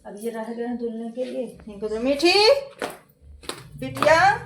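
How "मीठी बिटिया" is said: raised, lilting call to a daughter. Real, not acted. Calling out for the daughter. (बेटी को पुकारते हुए)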